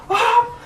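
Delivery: high, loud attack yell; hammed-up anime attack yell; mock battle cry. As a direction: shout as the punch lands.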